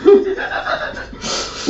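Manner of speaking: high-pitched giggle through words; can't stop laughing. Real, not acted. Recalling funny clip, laughing.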